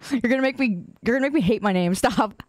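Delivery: ordinary delivery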